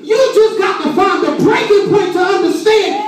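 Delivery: high-pitched, impassioned sermon shout; full-force preaching. Real, not acted. Preacher promises life in a dead situation, full-force preaching. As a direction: drive it home like a preacher at full cry. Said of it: "I feel preaching now".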